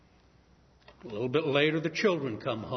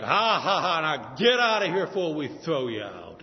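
loud laugh, then sneering order; mocking laugh; 5 dB louder than usual. Rich man laughing the poor man off his land, mocking. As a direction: laugh him out the door.

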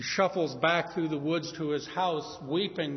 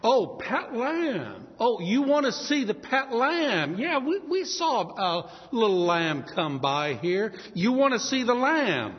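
higher, louder, mocking tone; pitiless mockery; 5 semitones higher, 4 dB louder than usual. Rich man taunting the poor man about his lamb, mocking. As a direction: taunt him with it. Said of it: "without any pity".

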